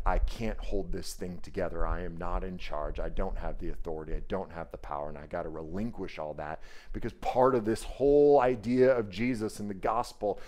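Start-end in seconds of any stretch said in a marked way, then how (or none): 0.0-6.6 low, soft voice giving in; soft confession. Retold or imitated. The girl admitting she is not in charge, low and soft. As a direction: admit defeat quietly, almost to yourself.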